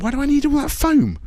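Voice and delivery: high-pitched baffled protest; indignant complaint. Retold or imitated. British drinker faced with a foamy Dutch beer, indignant. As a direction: raise the pitch in mock indignation. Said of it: "I was like".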